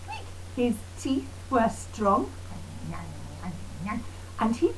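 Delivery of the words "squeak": tiny squeaky mouse squeak, very high and soft. Acted. Mouse squeaks in story song.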